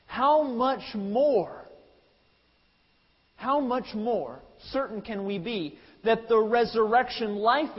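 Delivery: fervent preacher driving the point home, slowing on the climax; preaching at full force. Acted. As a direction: build the argument to a ringing climax.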